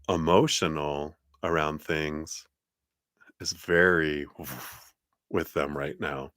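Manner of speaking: ordinary talk, nothing marked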